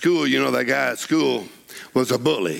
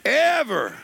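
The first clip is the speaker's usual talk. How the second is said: slow, swelling sermon cry; preaching at full force; 3 semitones higher, slower than usual. Acted. Preacher proclaiming the promise to the congregation, slow and rising.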